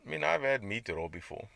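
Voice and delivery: usual speaking voice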